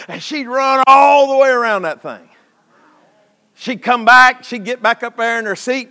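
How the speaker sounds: high, loud storytelling from the pulpit; preaching at full force. Real, not acted. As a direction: build the tale with loud, excited punches.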